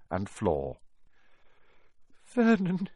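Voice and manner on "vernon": high, trembling call to a husband; quivering voice. A narrator's reading. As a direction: quaver his name fearfully. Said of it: "saying in a quivering voice".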